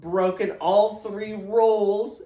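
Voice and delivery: loud deliberate dismay; loud slow complaint. Retold or imitated. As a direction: spell out the disaster, loud and slow. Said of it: "I was like".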